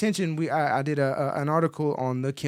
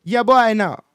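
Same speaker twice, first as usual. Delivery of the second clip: loud, high Jamaican-style shout; punchy mock Jamaican accent; put-on Jamaican accent; 6 semitones higher, 9 dB louder, slower than usual. Host hypes himself to co-host, playful Jamaican accent. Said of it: "I went from Jamaican to a Cockney accent".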